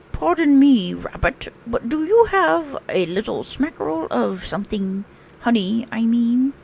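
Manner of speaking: a dreamy, simple little bear; Pooh. Acted. Pooh telling Rabbit about his pumpkin, dreamy bear voice.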